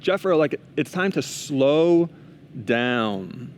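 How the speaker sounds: slow right down, dropping low on the last word; slow, deliberate counsel, winding down; drawn-out slowing. Retold or imitated. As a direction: stretch it out, then pause before a low finish. God's call to slow down, words slowed.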